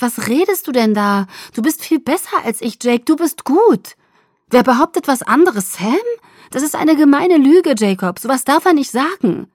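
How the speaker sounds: loud, high, upset shout. A narrator's reading. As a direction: shout it in disbelief. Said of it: "Jetzt schrie ich wieder". (empört schreiend)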